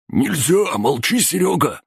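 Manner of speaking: very high-pitched goon yell; panicked cry. A narrator's reading. Fourth goon hushing Серёга, crying out high. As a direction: cry out urgently to stop a mate talking. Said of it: "закричал четвёртый".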